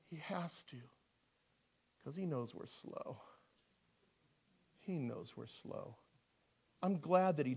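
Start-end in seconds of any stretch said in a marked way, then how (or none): 0.1-6.0 very soft, slow aside; quiet, knowing understatement; hushed confiding. Real, not acted. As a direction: drop to a near-whisper and let it land. Hushed punchline after shouted story.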